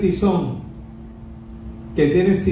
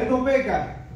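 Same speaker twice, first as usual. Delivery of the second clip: high, softer reverent echo; high hushed reverent repeat; hushed angel echo; 7 semitones higher, 5 dB quieter than usual. Retold or imitated. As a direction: let the praise echo back hushed. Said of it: "Está diciendo".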